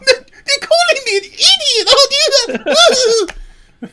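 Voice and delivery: very high, slow, fake-crying whine; hammed-up tearful mock complaint; mocking whiny impression. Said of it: "And he's going".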